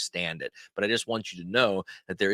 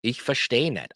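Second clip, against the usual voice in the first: words run together in one phrase; whole-phrase demo; 3 semitones higher than usual. Shown as a demonstration. Teacher models telling someone they don't understand, in one flow. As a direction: say it straight through without pauses. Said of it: "So you wanna say".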